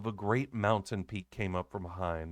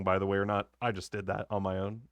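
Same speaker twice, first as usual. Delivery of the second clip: plain reading voice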